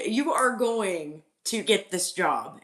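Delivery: slow, deliberate third repeat; slow emphasis. Shown as a demonstration. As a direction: spell it out word by word.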